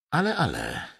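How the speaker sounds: absent-minded. A narrator's reading. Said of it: "rzekł z roztargnieniem".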